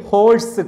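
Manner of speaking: ഹോഴ്സ് opening on an audible H; sounded H. Shown as a demonstration. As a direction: pronounce the H in ഹോഴ്സ് clearly. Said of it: "ഇങ്ങനെയൊക്കെ പറയുമ്പോൾ ഹ". (ഹോഴ്സ്, തുടക്കത്തിലെ 'ഹ' ഉച്ചരിച്ച്)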